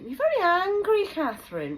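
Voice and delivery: mocking the staff who call her angry; mocking them. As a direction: mimic a condescending professional. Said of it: "That's what they say".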